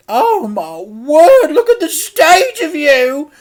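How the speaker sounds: very high, slow, loud fussing; a scandalised auntie; Auntie Flip, aghast. A narrator's reading.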